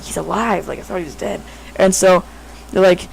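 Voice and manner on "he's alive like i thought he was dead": hushed and much softer, like a secret; retold crowd whisper. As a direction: whisper it in disbelief. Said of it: "they started, like, whispering".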